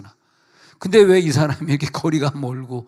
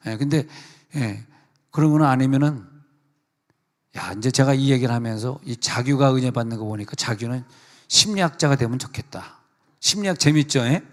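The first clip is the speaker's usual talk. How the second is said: normal talking voice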